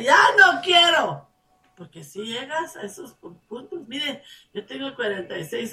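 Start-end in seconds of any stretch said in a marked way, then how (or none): 0.0-1.3 high, loud weary complaint; giving up. Retold or imitated. Tempted believer wanting to quit, loud and high. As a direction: say it like you've had enough. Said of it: "cómo decirte".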